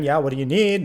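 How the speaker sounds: loud call from the shower; retold yell. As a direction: shout it to the door. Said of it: "so I yell again".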